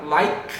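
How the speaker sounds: "like" ending in a crisp K sound; final K sounded. Shown as a demonstration. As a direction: say "like" with an audible K at the end, no vowel after it. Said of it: "tem esse k".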